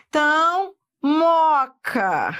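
moca said slowly, syllable by syllable; teacher modeling a word. Shown as a demonstration. Teacher models moca for students, drawn out. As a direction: spell out moca clearly for the class. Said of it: "É assim que você tem que falar".

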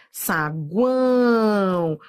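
Saguão stretched very slowly, each sound drawn out; slow demonstration. Shown as a demonstration. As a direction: draw out Saguão so the glides are heard. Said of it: "semivogal, vogal, semivogal".

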